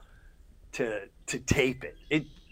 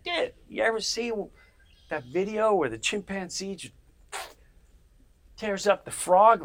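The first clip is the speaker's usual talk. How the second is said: friend pitching a video; 6 semitones higher than usual. Retold or imitated. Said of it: "and just like".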